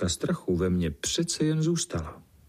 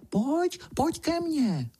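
very high, sly coaxing; wheedling little voice; slower than usual. Retold or imitated. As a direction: lure them closer in a high sing-song. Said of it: "šeptá úlisným hláskem". (úlisný vysoký hlásek)

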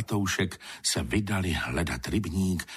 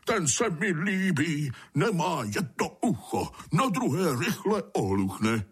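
put-on high, elated old sprite; joyful storybook cry; 9 semitones higher than usual. A narrator's reading. Old water sprite choosing a newcomer, crying out gladly. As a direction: exclaim it delightedly, pitched up. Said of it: "a zakřičel".

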